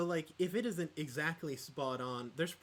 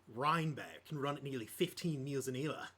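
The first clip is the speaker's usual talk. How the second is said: practised broad Australian accent. Shown as a demonstration. Player warming up for a game, Australian accent. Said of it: "I would enter into the accent".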